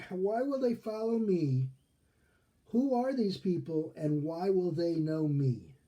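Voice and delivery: pleading protest. Acted. Moses the outsider asking God why they'd follow, pleading protest. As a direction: plead it, stretching the questions out.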